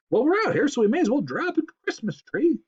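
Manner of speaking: a pushy mom deciding; Belinda's voice. Acted.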